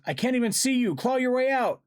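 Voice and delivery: very high, loud, desperate order; yelled. A narrator's reading. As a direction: shout urgently to trapped friends. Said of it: "I yelled".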